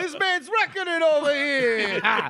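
high, loud, slowed-down mock shock; hammed-up disbelief at a friend's word; mock outrage. Mock outrage at friend saying reckon.

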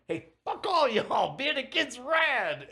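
high, loud carefree yell; rowdy dude shout. Retold or imitated. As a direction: holler it like a rebel kid. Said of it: "one dude's like".

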